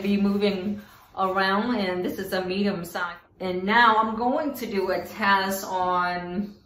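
speaking inside protective mask; through a fencing mask. Real, not acted. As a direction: voice behind mesh mask. Seen 1: Mesh fencing mask with bib worn over head throughout all frames.

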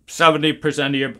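normal talking voice

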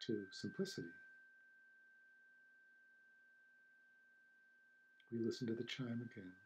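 unhurried meditation guide, long silences between phrases; guided meditation. Acted. Teacher guiding listeners to feel the silence after the chime, calm meditation voice. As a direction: ease listeners into stillness, leaving space after each phrase.

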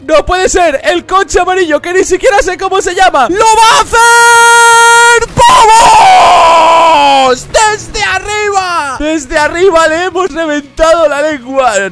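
high shouted hype commentary; wrestling commentator. Acted. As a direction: scream it like a ring announcer. Hyped play-by-play of car attack.

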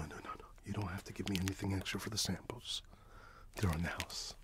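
ordinary talk

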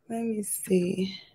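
whispered to herself, slowly; slow whispered aside. Real, not acted. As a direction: breathe it, barely voiced, unhurried. Muttering to self.

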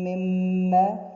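مما with the doubled m held and nasal; مما with a long humming mim; held ghunna. Shown as a demonstration. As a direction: hum the mim for two counts. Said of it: "mim with shadda".

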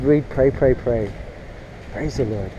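ordinary talk

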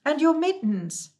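high, loud, slow pleading call; calling after. Acted. Moomin Troll calling after the departing Hemulan, loud and high. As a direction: call out urgently to someone walking away.

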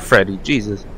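ordinary talk, nothing marked

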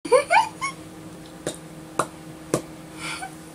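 breathless high-pitched giggle fit; real, helpless giggles; giggling at her own blooper. Real, not acted.

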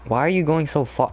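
normal talking voice

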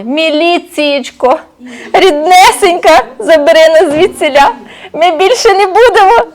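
благальні бандити. Acted. Розбійники благають на колінах.

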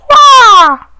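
very high, loud startled wail; wailing scream as he falls. Real, not acted. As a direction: yelp in panic. Falling in game.

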